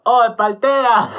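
a fan in the crowd heckles a loudmouth, drawn-out mocking yell; mock shout. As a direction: holler it slowly across the arena like a heckler. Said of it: "un pata le gritó".

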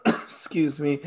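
coughing, voice strained after choking; choking on water. Real, not acted. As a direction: clear the throat and push the words out. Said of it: "Choking on water".

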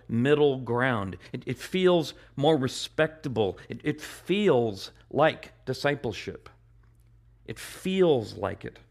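ordinary delivery

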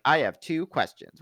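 put-on a touch of smart-aleck child; snarky kid. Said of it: "kinda kid snarky".

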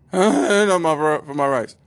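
high, loud cracked squeak on a mock declaration; comic voice crack while acting brave. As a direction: imitate a terrified man whose voice breaks. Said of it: "Voice cracked and whatnot".

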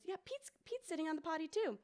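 put-on high, light little girl voice; toddler voice. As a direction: imitate a happy toddler. Toddler quoted in story.

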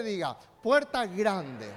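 loud with faith. Shown as a demonstration. Preacher leading the church in a call-and-response, loud. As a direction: lead the repeat with strong faith. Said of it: "con fe".

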